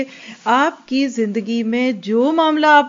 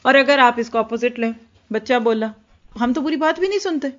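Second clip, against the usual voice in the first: normal talking voice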